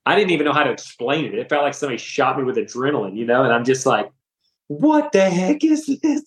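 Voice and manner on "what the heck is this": high, slow, astonished outburst; stunned exclaim. Retold or imitated. Retelling his shock at the dinner table, high astonished exclaim. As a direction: blurt it out like the moment just hit you.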